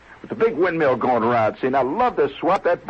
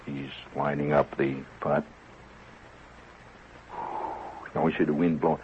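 hushed golf commentary; 10 semitones lower, 9 dB quieter than usual. Shown as a demonstration. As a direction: hush it like you're in church. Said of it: "They talk like this".

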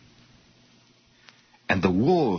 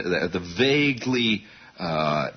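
ordinary delivery

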